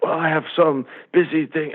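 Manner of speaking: high put-on voice of a complainer; mock objecting staffer. Imitates staff objecting to phone rule.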